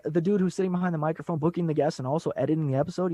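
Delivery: ordinary delivery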